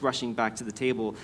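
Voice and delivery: ordinary delivery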